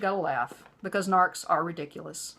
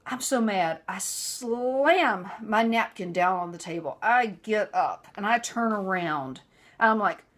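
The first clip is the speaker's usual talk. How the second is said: ordinary delivery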